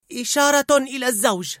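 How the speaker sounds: high character voice giving an order; commanding emotion. A narrator's reading. Mother's Sadness ordering the signal, commanding.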